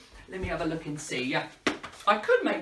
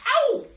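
high, loud pretend "ow"; mock pain yelp; 7 dB louder than usual. Balloon hits the entertainer's nose, comic pained yelp.

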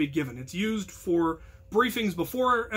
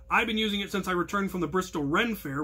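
normal talking voice

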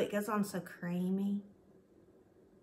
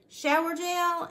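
excited; 9 semitones higher, 8 dB louder, slower than usual. Real, not acted. Drawn-out excited naming.

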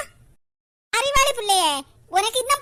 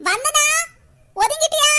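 calling out; 4 semitones higher, 3 dB louder than usual. Acted. Mother calls from another room.

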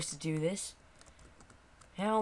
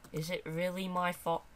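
ordinary delivery

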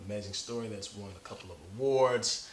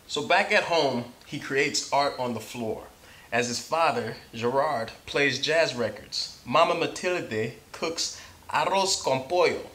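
bright, lively read-aloud, a bit higher and louder; story narration; 5 semitones higher, 9 dB louder than usual. Acted. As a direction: read it like to a class of kids. Reads picture book aloud.

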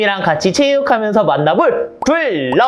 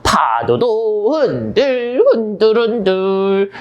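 slow swaying singsong; much slower than usual. Shown as a demonstration. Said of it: "파도를 만들어 볼 거야".

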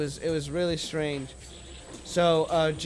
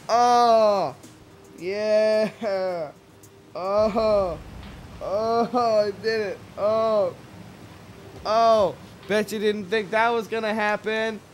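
loud, high, drawn-out crowing; exultant cries in a raised voice; triumphant gloating; 5 semitones higher, 5 dB louder, slower than usual. Real, not acted. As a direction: revel noisily in the win. Gloats after landing the joke.

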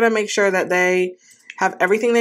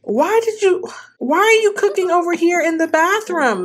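incredulous; 9 semitones higher than usual. Real, not acted. Sim cooking in the bathroom.

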